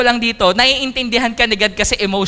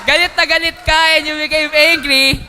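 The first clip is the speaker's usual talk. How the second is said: raised, higher voice acting out a fit of anger; hammed-up anger; 5 semitones higher than usual. Preacher acting out an angry person to the congregation, mock fury.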